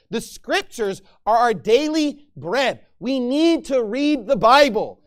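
loud, driving sermon exhortation, slowing on key lines; preaching at full force. Acted. Pastor urging his congregation to read, fervent preaching. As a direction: hammer it home to the congregation.

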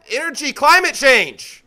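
mock politician grasping for an answer; floundering politician. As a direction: blurt buzzwords as a mock answer. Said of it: "make up stuff on the fly".

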